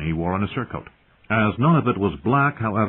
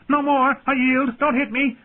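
very high, shrill terrified squeal. Acted. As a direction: shriek it out in panic. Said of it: "he shrilled".

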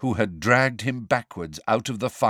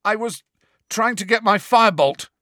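croaked; 12 semitones higher, 6 dB louder than usual. A narrator's reading. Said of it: "Harry croaked".

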